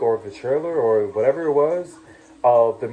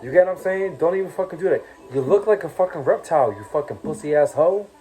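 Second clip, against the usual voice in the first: heated insults; 5 semitones higher than usual. Real, not acted. Heated rant insulting Lilo.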